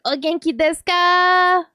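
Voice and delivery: put-on high, sweet anime-girl voice; cutesy anime greeting. Streamer greets a viewer in a high cutesy anime voice. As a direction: coo the Japanese greeting like a cartoon girl.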